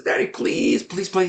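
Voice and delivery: put-on breathy then high pleading child; small son begging his father to play. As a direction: plead like a little boy tugging a shirt. Son tugs father's shirt, begging.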